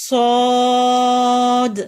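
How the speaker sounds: صاد slow and loud, thick hiss, long aa; heavy hissed letter. Shown as a demonstration. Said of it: "الف کے ساتھ لمبا کرنا ہے".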